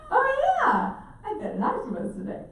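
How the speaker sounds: very high laughing oh yeah, then casual; laughing breezy reply. Retold or imitated. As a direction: toss it off laughing.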